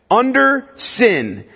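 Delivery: "Under sin" drawn out slowly, stress on under; slow it right down and lean on "Under". Shown as a demonstration. Pastor repeating the key phrase for emphasis, slow stress. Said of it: "underline the words in your Bible".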